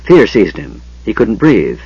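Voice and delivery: plain reading voice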